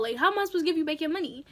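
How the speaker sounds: ordinary delivery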